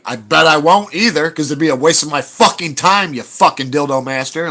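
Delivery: loud, high comeback; a loud dismissive retort; retorting loudly. Retold or imitated. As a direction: snap back at the heckler. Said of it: "And I'm like".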